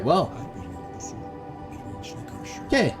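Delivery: usual speaking voice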